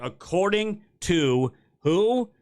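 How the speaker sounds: slow, pointed reveal, word by word; slow mock-suspense, then the drop; sarcastic emphasis. Real, not acted. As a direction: draw it out with scornful weight. Mocks the source while reading.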